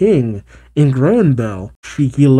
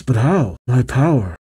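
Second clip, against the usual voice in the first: ordinary delivery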